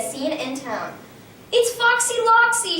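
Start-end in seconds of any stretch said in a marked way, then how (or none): 1.5-2.8 scream. A narrator's reading. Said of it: "she screamed".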